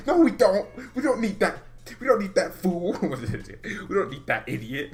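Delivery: high, emphatic, exasperated refusal; heated refusal. Real, not acted. Fan rejecting calls to buy Werner, heated. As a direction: shoot the idea down, riled up.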